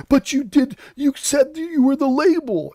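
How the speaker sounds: high, mocking whine, voicing the host; mocking imitation. Commentator mocks the host's rebuttal, high mock whine. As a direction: make the host sound petty and shrill. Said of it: "He goes".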